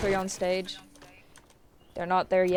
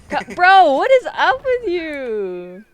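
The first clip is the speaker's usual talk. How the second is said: laughing; 5 semitones higher, 9 dB louder, slower than usual. Real, not acted. Teammate baffled by giggling friend.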